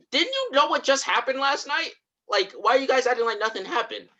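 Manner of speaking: high-pitched acted disbelief; incredulous mock protest. Atticus asking why they act like nothing happened, incredulous. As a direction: imitate baffled pleading, pitched well up. Acting Atticus confronting the others.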